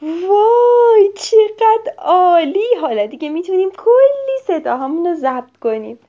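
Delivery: put-on overjoyed child yelling; delighted shout. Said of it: "میکو داد زد".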